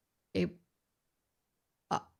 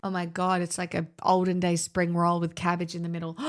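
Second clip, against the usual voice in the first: usual speaking voice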